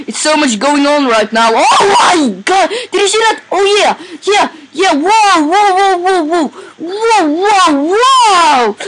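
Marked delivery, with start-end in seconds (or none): 1.5-8.7 adrenaline rush. Real, not acted. Thrilled by chaotic game action.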